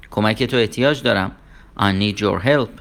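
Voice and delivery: usual speaking voice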